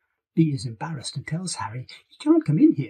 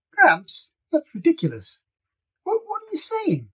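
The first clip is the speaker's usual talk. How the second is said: put-on higher, incredulous girl's voice; teen girl protest; 14 semitones higher than usual. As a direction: play the baffled granddaughter. Bee protests.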